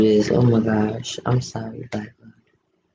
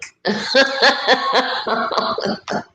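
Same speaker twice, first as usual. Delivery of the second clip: giggly laugh; 10 semitones higher than usual. Real, not acted. Laughing at her own joke.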